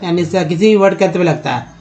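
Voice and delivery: usual speaking voice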